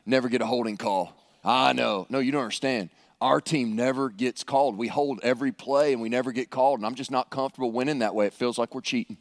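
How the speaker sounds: low, mock-earnest fan voice; two football fellas. Two fellas talking after a football game, mock-troubled. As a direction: drop the pitch and play a grumbling fella. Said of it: "can you imagine two fellas talking after a football game".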